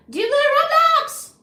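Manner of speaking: high loud eager question; excited question. Pretend. Streamer reading a chat question, high loud and eager. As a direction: ask it with big excitement.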